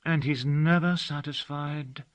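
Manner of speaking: put-on a girl sadly naming her unforgiving master. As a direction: let it sink with sorrow. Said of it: "said the child sadly".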